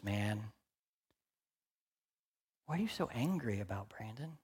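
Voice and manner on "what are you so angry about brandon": softer, faster, gentle inner voice; a soft, gentle question from Jesus. Retold or imitated. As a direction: ask him quietly what's wrong.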